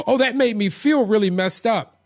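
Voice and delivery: high, loud mock upset outburst; emotional overreaction. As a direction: blurt it out like someone ruled by feelings. Voices someone reacting on feelings.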